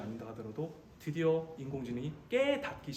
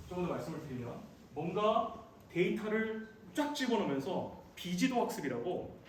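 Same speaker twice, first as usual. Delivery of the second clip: ordinary talk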